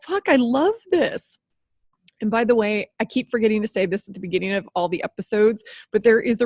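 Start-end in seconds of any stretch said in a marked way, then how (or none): 0.0-1.4 high, loud and slow with real delight; thrilled. Real, not acted. Host fired up about her podcast, real gleeful exclamation. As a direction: savour it as if you can't contain the joy. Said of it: "I'm laughing because I'm like".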